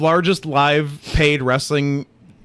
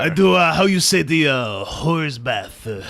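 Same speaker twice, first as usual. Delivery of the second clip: put-on French Canadian accent. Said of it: "He's us doing a French Canadian accent".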